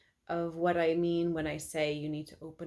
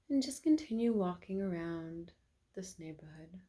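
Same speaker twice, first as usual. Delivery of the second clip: guided meditation. Acted. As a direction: lead them calmly through the imagined walk.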